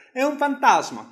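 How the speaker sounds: high, loud cry of fright; frightened cry. Acted. Disciples crying out at a ghost on the sea, high frightened cry. Disciples see Jesus on water.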